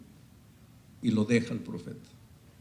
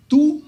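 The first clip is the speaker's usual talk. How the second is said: TÚ cried out high and loud; emphatic reveal; 10 dB louder than usual. Acted. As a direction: land Tú as a sudden loud peak. Dios revela su identidad. (Tú, exclamado agudo y fuerte)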